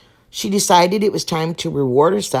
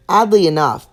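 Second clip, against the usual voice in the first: ordinary delivery, nothing marked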